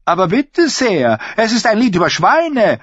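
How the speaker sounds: high mincing mock voice; mocking the singer. Royce mimicking the singer's excuse, high and mocking. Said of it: "hat er behauptet".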